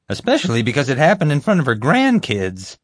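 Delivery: high, loudish, hedging voice; bumbling guard. Acted. Guard admitting his taser accident, sheepishly. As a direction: own up in a high-pitched guard voice.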